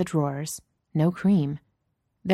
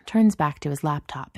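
ordinary delivery, nothing marked

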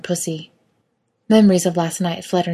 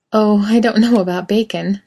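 little laugh; 4 semitones higher, 6 dB louder than usual. A narrator's reading. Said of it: "with a little laugh".

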